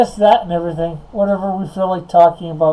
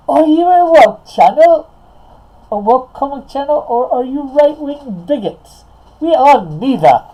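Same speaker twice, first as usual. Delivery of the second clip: high, put-on British voice; British accent; 9 semitones higher, 6 dB louder than usual. Reading a chat question and answering it, in a British accent. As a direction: keep up a swooping, high-pitched British accent. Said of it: "Keep the accent".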